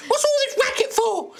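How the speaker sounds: put-on irate old neighbour; shouting old lady. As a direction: yell down at noisy people like a cranky old lady. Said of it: "an old lady kind of".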